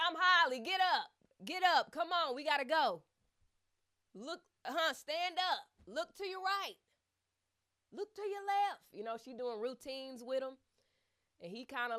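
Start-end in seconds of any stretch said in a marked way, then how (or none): 0.1-8.8 loud, much higher, barking orders like a strict therapist; stern, assertive Holly. Retold or imitated. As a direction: drill him like a no-nonsense physio. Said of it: "stern, assertive".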